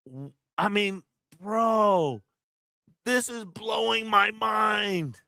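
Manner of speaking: blown away, almost shouting; amazed shout. Real, not acted. Streamer watches the original motion capture, shouting in awe.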